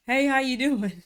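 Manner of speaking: acting out her old breathless small talk; imitating breathless talk. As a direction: mimic running out of air mid-hello. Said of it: "I'd be talking and running out of breath".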